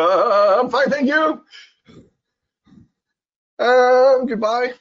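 high, loud nervous brush-off; flustered learner. Acted. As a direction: rush out an awkward goodbye. Learner flustered, ends conversation.

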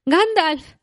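high, loud cry of a name. A narrator's reading. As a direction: cry out to Gandalf in relief. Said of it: "gritó". (grito alto)

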